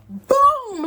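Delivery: loud, high booming hit; imitated punch impact. Storyteller mimics the punch to her face, booming loud. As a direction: punch out a big, high boom.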